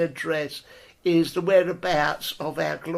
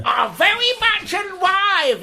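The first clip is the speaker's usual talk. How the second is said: much higher, louder boast; high leader voice; 13 semitones higher, 5 dB louder than usual. Retold or imitated. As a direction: insist proudly you're alive. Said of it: "he says".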